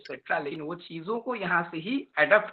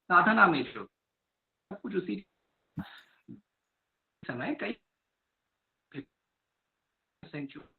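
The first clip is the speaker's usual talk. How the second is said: bad connection; 3 semitones higher than usual. Real, not acted. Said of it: "आपकी आवाज बहुत cut रही है".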